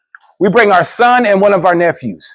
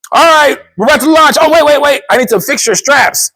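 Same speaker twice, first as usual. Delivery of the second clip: loud, high operator call-out; ride operator; 9 semitones higher, 4 dB louder than usual. Retold or imitated.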